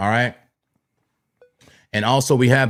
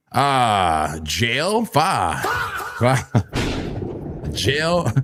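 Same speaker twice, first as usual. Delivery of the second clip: laughing; 2 semitones lower, 3 dB quieter, much slower than usual. Real, not acted. Laughs at chatter from jail.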